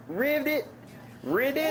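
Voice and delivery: high frog ribbit. Shown as a demonstration. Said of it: "What sound does a frog frog make?".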